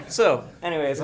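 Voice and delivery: loud, still laughing as he talks; laughing through the words. Real, not acted. Laughing after the punchline.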